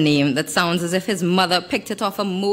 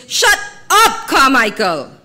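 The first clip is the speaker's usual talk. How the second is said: much higher, louder shout of shut up; shouted order; 8 semitones higher, 7 dB louder than usual. A narrator's reading. Model silencing the photographer, angry shout. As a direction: cut him off with a furious yell.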